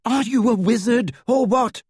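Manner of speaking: high, exasperated scolding; incredulous, high-pitched rebuke. A narrator's reading. Winded friend scolding him in exasperation.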